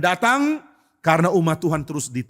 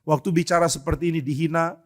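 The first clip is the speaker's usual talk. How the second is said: usual speaking voice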